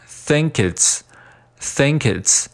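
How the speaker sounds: "think it's" joined as "thin-kits"; linked words. Shown as a demonstration. Teacher drilling liaison, "think it's" linked slowly as "thin-kits". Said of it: "think和it连读".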